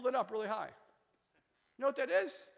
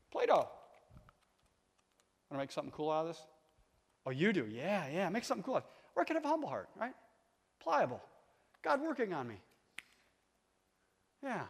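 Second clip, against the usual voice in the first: ordinary talk, nothing marked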